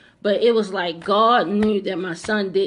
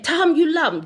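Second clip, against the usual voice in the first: higher, urgent command voice; retold insistent voice; 8 semitones higher, 4 dB louder than usual. The voice commanding her to say she loves her son, urgent. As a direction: repeat the order firmly, higher. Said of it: "just like that".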